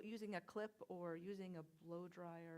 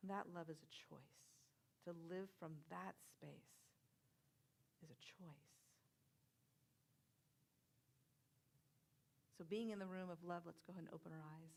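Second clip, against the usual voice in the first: guided meditation; 3 semitones lower, 9 dB quieter than usual. Acted. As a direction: gently guide the room into feeling love. Leads a love meditation.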